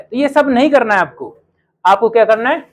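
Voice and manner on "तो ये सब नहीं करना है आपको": ordinary talk, nothing marked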